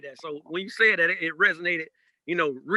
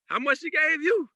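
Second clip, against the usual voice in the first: put-on retelling his childhood self asking the preacher, in a high kid voice; kid asking about pay; 6 semitones higher than usual. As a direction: play the nosy little boy comparing pay. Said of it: "I like".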